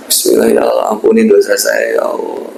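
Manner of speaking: demonstrating humble, sorry prayer; remorseful prayer. Shown as a demonstration. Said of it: "Dengan bahasamu pun nggak apa-apa".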